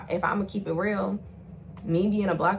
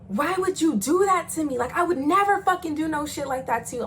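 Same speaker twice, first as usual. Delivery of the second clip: angry hurt cry; 7 semitones higher, 4 dB louder than usual. Retold or imitated. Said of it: "crying out of anger".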